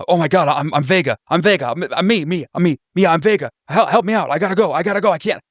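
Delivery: babbling in terror to get loose; freaking out. Acted. Trapped man Vega panicking at the strangers, freaking out. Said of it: "he's like, ... he's just freaking out".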